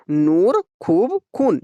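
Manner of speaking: नूर, खूब, खून with a lengthened oo; drawn-out vowels. Shown as a demonstration. Teacher recapping maroof, नूर, खूब, खून drawn out on oo. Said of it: "ये हम लोग खींच कर पढ़े हुए हैं".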